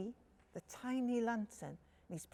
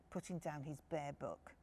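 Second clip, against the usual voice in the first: ordinary delivery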